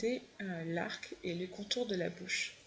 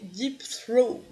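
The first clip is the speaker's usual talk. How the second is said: French-accented English; 2 semitones higher, 8 dB louder than usual. Real, not acted. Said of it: "Avec mon super accent".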